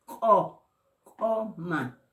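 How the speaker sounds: CO, COMA with a popped throat consonant; glottalized Q. Shown as a demonstration. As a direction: isolate the ejective CO, then say COMA. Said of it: "con el apóstrofo".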